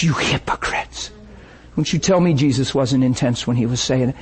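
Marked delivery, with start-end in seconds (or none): 0.0-1.1 soft, hissed you hypocrites; whispered. Acted. Jesus rebuking Pharisees, dropped to a whisper. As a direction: whisper the accusation.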